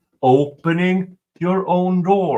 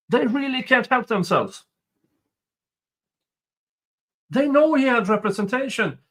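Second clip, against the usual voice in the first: disbelieving complaint, pitched up; exasperated; 6 semitones higher than usual. Real, not acted. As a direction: sound fed up with them. Rants at the prosecution's conduct.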